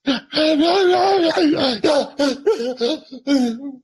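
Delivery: high, breath-catching imitated sobs; hammed-up strangled weeping in bursts; stifled sob. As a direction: act out a cry you can't hold in. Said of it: "trying to stifle a cry and your breath catches".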